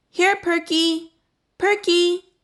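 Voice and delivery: high, slow calling for the lost dog. A narrator's reading. Boy calling his missing dog, high and slow. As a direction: call the dog's name across the house. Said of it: "called Arthur".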